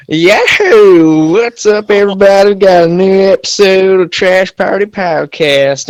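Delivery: loud hillbilly podcast host. Acted. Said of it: "intro like a redneck".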